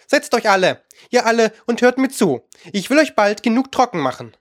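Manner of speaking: mouse calling out. A narrator's reading. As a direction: summon the crowd like a small bossy mouse. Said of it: "Endlich rief die Maus".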